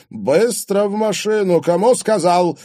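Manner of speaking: loud, high-pitched angry bark; barked order. A narrator's reading. Father orders grown daughter into car, barking. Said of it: "рявкнул Платон багровея".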